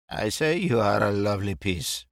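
a lightly voiced tipsy Englishman, fond; tipsy Mike. Drunk Mike praises Brett at the bar, tipsy and fond. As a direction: slur it a little, besotted.

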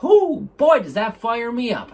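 loud whooping burst of joy; excited whoop. Real, not acted. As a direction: whoop it out, fired up. Excited outburst about passions.

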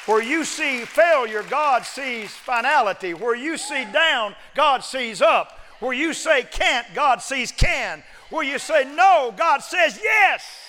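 slow, high, loud building preacher shout; preaching at full force. Acted. Preacher rallying the congregation with full force.

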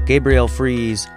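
normal talking voice

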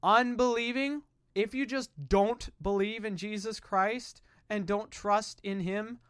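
ordinary talk

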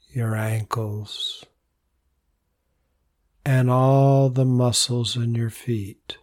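calm, unhurried voice with long pauses; soothing meditation lead, phrases trailing softly; guided meditation. Real, not acted. Leads a body relaxation meditation.

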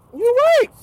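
high squeaked agreement; silent agreement look. Pretend. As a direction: mouth it high and tight. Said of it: "on some, like".

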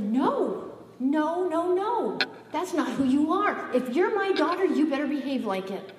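loud, high, emphatic parental reprimand; God scolding. Retold or imitated. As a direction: scold like a parent laying down the law. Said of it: "But God said".

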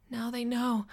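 tense and high; anxious realization. A narrator's reading. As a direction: voice it as dawning panic. Panicking narrator realizes she's exposed.

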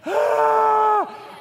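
high, loud pleading whine; exaggerated wordless wail of a begging kid; mock begging wail. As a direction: let out a hammed-up desperate cry. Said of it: "literally begging on my hands and knees".

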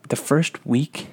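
ordinary talk, nothing marked

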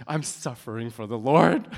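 loud, hammed-up suffering; mock martyrdom. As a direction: play the put-upon martyr, loudly. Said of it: "I got so angry".

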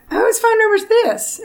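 high, puzzled question; surprised question. Retold or imitated. Wife finds strange number, asks in high surprise.

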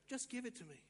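suddenly soft invitation; gentle urging. Acted. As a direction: coax quietly after the big promise. Jesus drops to gentle invitation.

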